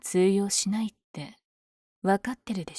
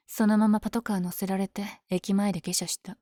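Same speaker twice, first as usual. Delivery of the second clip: normal talking voice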